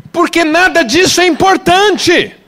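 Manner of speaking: loud, high cry to the church; preaching shout. Real, not acted. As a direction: call it out. Preaching peak.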